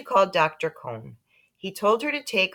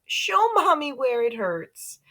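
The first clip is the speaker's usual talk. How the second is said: higher, slow coaxing; cooing mother; 9 semitones higher, slower than usual. A narrator's reading. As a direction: coax the toddler gently.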